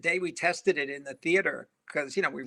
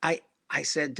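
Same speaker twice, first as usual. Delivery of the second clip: usual speaking voice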